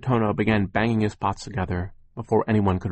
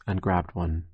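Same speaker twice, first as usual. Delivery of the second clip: plain reading voice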